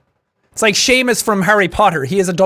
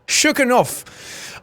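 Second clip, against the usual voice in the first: Irish slang delivery; 3 semitones higher, 4 dB quieter, much slower than usual. Retold or imitated. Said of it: "ways of saying".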